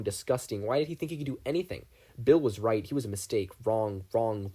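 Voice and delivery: ordinary delivery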